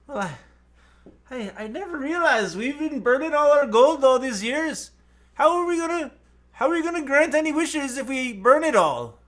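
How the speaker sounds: high, fretting Irish leprechaun; Irish accent. Acted. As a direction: worry aloud in a high put-on Irish brogue. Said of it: "making me do the accent".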